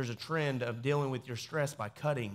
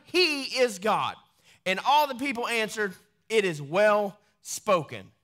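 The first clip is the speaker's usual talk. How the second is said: raised, ringing Bible reading; dramatic scripture reading; 6 semitones higher, 4 dB louder than usual. Acted. Preacher reading First Kings to his church, in a raised proclaiming voice. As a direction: proclaim the passage to the congregation.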